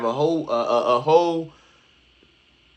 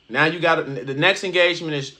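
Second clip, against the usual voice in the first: ordinary talk, nothing marked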